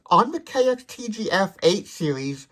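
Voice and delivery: ordinary delivery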